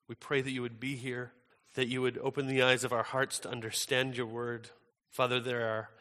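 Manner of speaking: soft, measured public prayer. Real, not acted.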